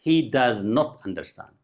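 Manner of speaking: very slow, careful word-by-word model; teacher's deliberate corrected sentence; slow correction. Shown as a demonstration. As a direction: draw out "does not" as separate words. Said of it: "ጌጋ".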